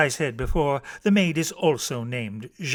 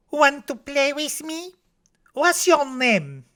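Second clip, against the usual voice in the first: put-on high little-girl voice; Josette; 11 semitones higher than usual. A narrator's reading. Small girl meeting a child in a store, high voice. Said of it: "Josette says".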